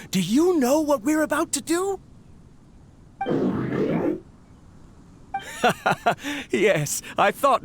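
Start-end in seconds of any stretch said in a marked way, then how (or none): 3.2-4.2 breathy self-satisfied laugh; smug chuckle. Acted. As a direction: chuckle at the secret plan. Scientist boasting of his plan.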